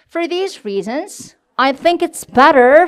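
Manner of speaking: very slow, pause after reasons; rest, then lean into better; pause then stress. Shown as a demonstration. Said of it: "쉬고 I think it's better".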